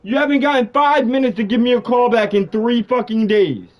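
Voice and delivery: loud, furious demands; shouting at a friend who dodged his calls; angry shouting. Real, not acted. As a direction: yell at him for ignoring calls. Angry call about unreturned calls.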